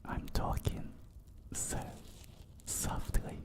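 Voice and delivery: hushed, teasingly soothing whisper. Real, not acted. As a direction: whisper word by word, with long pauses.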